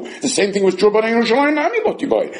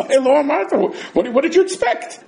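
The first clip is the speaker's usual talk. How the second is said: mock exasperation; 8 semitones higher than usual.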